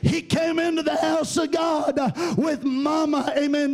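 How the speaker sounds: rhythmic, ringing phrases punched out with "uh"; full-force preaching. Real, not acted. Preacher testifies to the church about the boy, fervent preaching.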